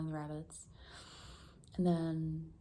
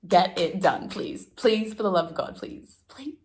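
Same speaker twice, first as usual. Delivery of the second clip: louder, desperate begging, rising on each please; loud pleading; 3 semitones higher, 12 dB louder, slower than usual. Real, not acted. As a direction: plead for the love of God. Begging herself to finish work.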